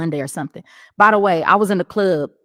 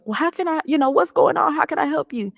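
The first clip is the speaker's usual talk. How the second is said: lightly voiced, higher church lady; a hint of a gentle lady at the door; kind church lady; 9 semitones higher than usual.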